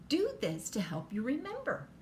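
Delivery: usual speaking voice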